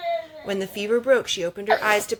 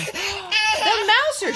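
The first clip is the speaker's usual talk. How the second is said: high, slow, delighted cry of The mouser; excited exclaim; 13 semitones higher, much slower than usual. Acted. Mother voicing Faith spotting the cat below, delighted. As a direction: stretch it out in bright delight. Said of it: "she exclaimed".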